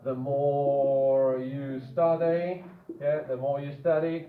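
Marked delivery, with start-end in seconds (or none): 0.1-2.6 very slow, each word laid out; slow dictation. Shown as a demonstration. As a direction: dictate it slowly to the class. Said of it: "the typical structure that we already know".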